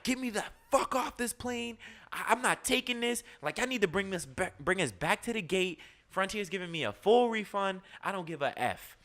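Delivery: high, angry Brooklyn woman yelling; Brooklyn woman freaking out. Retold or imitated. Passenger woman furious at the crew, high angry yelling. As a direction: pitch way up and demand to get off. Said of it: "a woman who starts freaking out".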